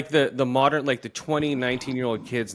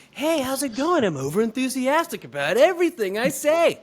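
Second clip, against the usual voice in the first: much higher, over-eager fake cheer; mock social media voice; 10 semitones higher, 4 dB louder than usual. Imitating people who only socialize online, high and over-eager. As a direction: sell every word like a fake online persona. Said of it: "this voice of people who don't socialize".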